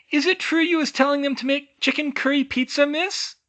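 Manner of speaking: high squeaky elf question; curious young elf. A narrator's reading. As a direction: tease her about the pizza. Sonia asks, giggling.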